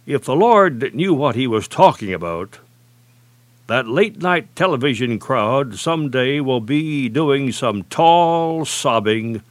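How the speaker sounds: ordinary talk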